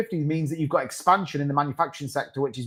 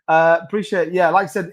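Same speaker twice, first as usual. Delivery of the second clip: ordinary talk, nothing marked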